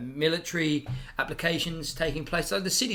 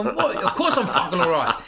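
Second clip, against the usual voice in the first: sharp, much higher, louder retort; coming in hot; 4 semitones higher, 7 dB louder than usual. Retold or imitated. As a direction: bark it back defensively, hot-headed. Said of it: "I'm coming in hot".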